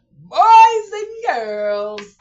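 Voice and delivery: high, loud, slow drawn-out call. Real, not acted. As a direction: sing out to the whole audience. Calling out to her audience.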